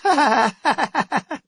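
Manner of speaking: a high laugh spilling into the word; chuckling at his own gag; laughing at own joke. A narrator's reading. Pleased with his goat joke, laughing.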